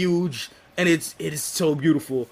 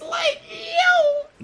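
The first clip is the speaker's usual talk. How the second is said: cracking up in disbelief; laughing yelp. Real, not acted. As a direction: shriek it through laughter. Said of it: "[name] know what's up".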